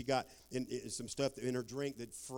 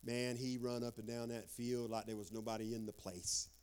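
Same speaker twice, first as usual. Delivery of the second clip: ordinary talk, nothing marked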